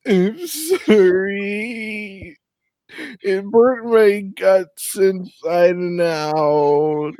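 put-on high, slow blubbering drunk sob; drunk crying. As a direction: wail it out like a weepy drunk. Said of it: "start drunk crying and saying".

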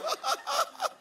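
very high squeaky giggle; imitated giggle. Pastor imitating a member's laugh, high squeaky giggle. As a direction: mimic a congregant's laugh. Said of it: "her teeth go".

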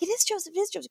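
high, soft insistent mother's whisper-tone; hushed reassurance. Retold or imitated. Mom quietly insisting to her son, high and soft. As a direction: soothe the child quietly but firmly. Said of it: "Mom was like".

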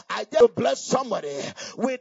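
ordinary delivery

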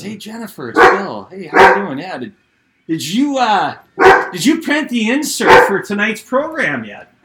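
a chipper boss calling the office; upbeat coach on phone. Retold or imitated. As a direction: coach phoning the front office, brightly voiced.

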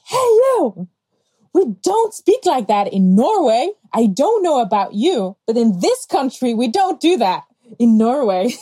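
high, loud call then firm scolding; loud confrontation. Retold or imitated. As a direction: shout her down on the subway. Confronting the racist woman.

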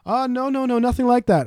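protesting scam caller. Retold or imitated. Scammer denies wanting card details, high mock protest. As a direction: imitate the scammer backpedalling in a high voice. Retold scammer denying again.

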